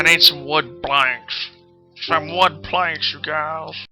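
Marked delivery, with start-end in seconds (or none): none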